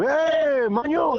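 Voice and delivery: high-pitched mocking yell of a clichéd Aragonese; mock shout of an imitator. Imitating outsiders who mock his accent, high mock shout. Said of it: "la gente que nos imita por ahí".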